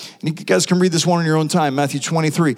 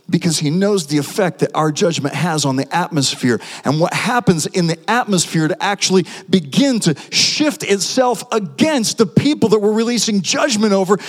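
ordinary talk, nothing marked

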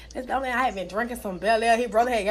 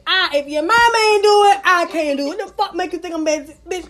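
raised rant; 5 semitones higher, 7 dB louder than usual. Real, not acted. Ranting at men.

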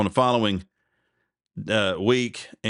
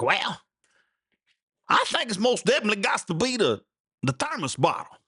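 imitating an old boy, pitched way up; hammed-up country fellow mulling it over; high put-on yokel voice; 10 semitones higher than usual.

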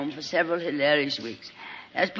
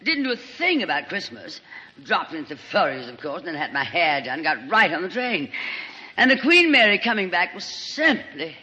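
gushing Lorraine. Acted. Lorraine greeting injured Sheridan, gushing and theatrical. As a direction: fawn over him like a melodramatic diva.

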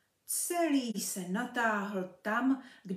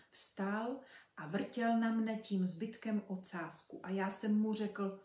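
usual speaking voice

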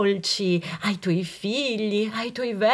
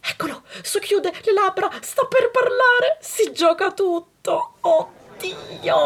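excited suspense; 9 semitones higher than usual. Acted. Breathless suspense narration.

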